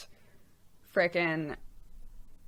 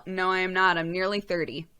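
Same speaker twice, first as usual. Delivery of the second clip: ordinary delivery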